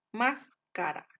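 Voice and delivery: máscara with a loud MÁS; tonic syllable stressed. Shown as a demonstration. Teacher showing the tonic syllable, máscara with punched MÁS. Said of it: "la mayor intensidad de voz".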